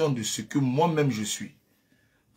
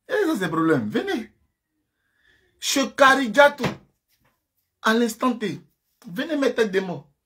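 much higher and louder, jeering at rivals with laughs; fired-up taunting; 10 semitones higher, 6 dB louder than usual. Real, not acted. Host hyping up the live, taunting rival streamers, loud high jeering. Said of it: "Quand je parle comme ça".

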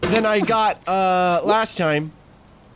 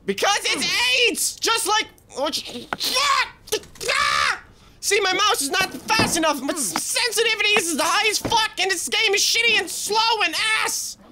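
high shouted rant; angry rant; 10 semitones higher than usual. Real, not acted. Gamer ranting at the game, angry shout. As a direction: vent at the game.

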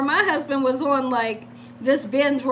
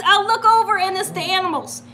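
ordinary delivery

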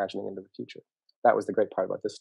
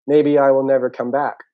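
loud, higher, meant to be overheard; pointed announcement; 4 semitones higher, 9 dB louder than usual. Acted. Turtle leaving the sleeping pair, pointed loud announcement. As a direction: announce the threat for others to hear. Sulking turtle walks away, wanting notice.

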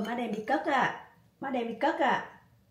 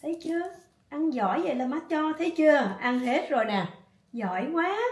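higher, bright praise for the dog after she eats; delighted praise; 5 semitones higher than usual. Real, not acted. Owner praising her dog Isa for finally eating, delighted and higher. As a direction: cheer the dog on, pleased.

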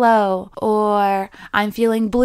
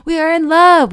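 higher, louder giddy gush; gushing in love; 6 semitones higher, 5 dB louder than usual. Retold or imitated. Mimicking a giddy new lover, higher and louder.

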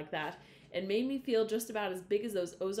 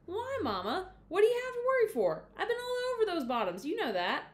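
put-on much higher, indignant young boy; protesting boy; 11 semitones higher, 4 dB louder than usual. A narrator's reading. Jay Barry protesting to Mama, high boyish voice. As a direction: brush off her worry, pitched way up.

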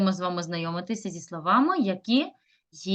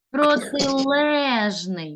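"Протилежний" with a long, raised stressed ЛЕ; stressed syllable; 4 semitones higher, much slower than usual. Shown as a demonstration. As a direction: stretch the stressed "ле" in "Протилежний" very slowly. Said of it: "прочитаємо слово з наголосом". ("Протилежний" повільно, з протяжним наголошеним "ле")

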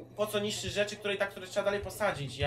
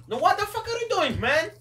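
exasperated yell; 9 dB louder than usual. Real, not acted. Teammate attacks him.

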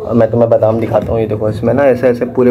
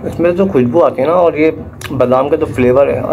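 chewing while talking; speaking between bites; mouth full; 4 semitones higher than usual. Real, not acted. Seen 0: Took a bite at -7; lips pursed, chewing motions while speaking.

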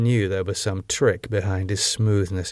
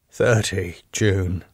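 ordinary delivery

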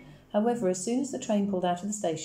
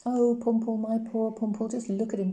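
louder, doting cry over the dog; fussing cry; 5 semitones higher, 4 dB louder than usual. Acted. Grandmother fretting over her begging dachshund, in a doting louder cry. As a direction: gush over poor Pumpel, a touch hammed-up. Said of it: "cried Amama".

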